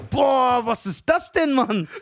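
loud, high retold outburst; shocked reaction. Telling friend his reaction to the rapper, loud mock shock. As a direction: blurt it out loud and appalled, then drop. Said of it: "Und ich so".

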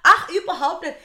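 high, emphatic comic repeat; exaggerated agreement. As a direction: brush it off loudly.

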